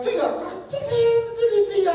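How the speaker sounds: very high, singsong mockery; loud squeaky taunt; teasing cousins. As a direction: imitate kids laughing at him. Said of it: "They were like".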